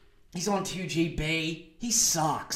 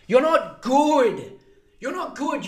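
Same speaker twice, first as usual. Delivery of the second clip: higher, louder and slow, yelling it; mock-furious yell; 8 semitones higher, 6 dB louder than usual. Yelling at Ben Fordham that he's not good, higher and louder. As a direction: bellow the insult at him.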